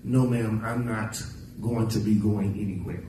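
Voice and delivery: low, subdued polite refusal; mock proper refusal. Shown as a demonstration. Preacher shows the proper way to refuse, low and restrained. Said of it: "The proper way is to say".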